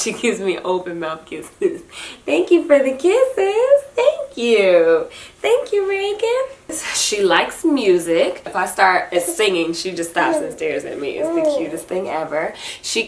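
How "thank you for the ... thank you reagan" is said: much higher, slow, loving coo. Real, not acted. Mother thanks baby Reagan for kisses.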